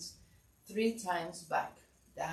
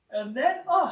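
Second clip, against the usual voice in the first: yawning mid-sentence; 7 semitones higher, 8 dB louder than usual. Real, not acted. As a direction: talk through a yawn. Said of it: "I'm yawning".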